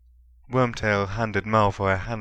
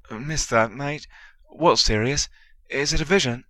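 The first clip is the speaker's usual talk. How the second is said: shaky voice; 6 semitones higher, 4 dB louder than usual. A narrator's reading. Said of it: "said quickly in a shaky voice".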